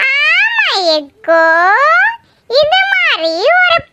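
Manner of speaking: high, slow, dreamy swoon over the groom; aunty gushing over the handsome groom, slow and high; swooning admiration. Acted. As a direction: stretch every word out like you're smitten.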